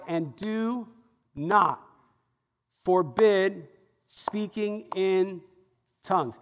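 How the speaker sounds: slow, spaced-out reading with pauses; emphatic word-by-word. Shown as a demonstration. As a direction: isolate "not", "forbid" and "tongues" with pauses.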